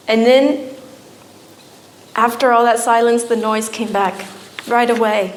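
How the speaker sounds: ordinary delivery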